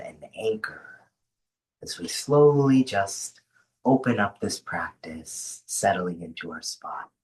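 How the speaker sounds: unhurried grounding voice with long pauses; guided meditation. Acted. Host settling an online group into rest, guided meditation. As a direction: ease them into rest, leaving space between phrases.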